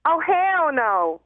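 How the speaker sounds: London woman drawling hell no, Texas style, slow; Texan drawl. Shown as a demonstration. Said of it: "that's not bad".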